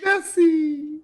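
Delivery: high-pitched fond call; calling the cow. Acted. Player calls to the cow.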